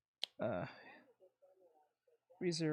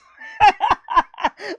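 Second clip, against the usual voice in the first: loud laugh; 11 semitones higher, 9 dB louder than usual. Real, not acted. Laughs at dad's excuse.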